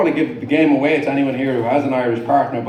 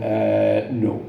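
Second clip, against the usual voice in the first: lower, deadpan retold sarcastic answer; reflexive sarcasm; 6 semitones lower than usual. Fallen cyclist answering a concerned lady, sarcastic. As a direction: drop it low, mock hesitation. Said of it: "before I could stop myself".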